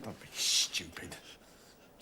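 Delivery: slow, hushed, warning under the breath; urgent whisper. Acted. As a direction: whisper it so the gunman alone hears. Hostage warns gunman privately.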